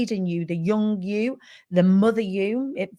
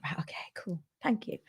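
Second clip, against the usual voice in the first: barely-there whispered thanks, half awed; hushed whisper; 3 semitones lower, 13 dB quieter than usual. Retold or imitated. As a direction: breathe it out in a whisper, no voice. Meek whispered reply to the giant.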